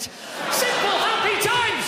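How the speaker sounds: high, loud hammed-up cry of nostalgia; mock nostalgic shout. Comic to the crowd, a hammed-up nostalgic shout. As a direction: belt it out like a soppy old-timer.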